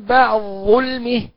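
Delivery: slow بعد ظلمه with the د swallowed into the ظ; full merging. Shown as a demonstration. Teacher showing full assimilation of dal into zha. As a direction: merge the dal fully into the zha. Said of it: "تقرأ هكذا".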